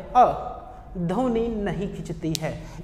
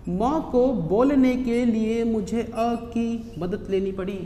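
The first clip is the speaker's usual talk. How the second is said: slow, deliberate teacher explanation; slow demo. Shown as a demonstration. Teacher showing students how म needs अ, slowly. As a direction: spell it out slowly for the class. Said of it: "teacher shows how म needs अ".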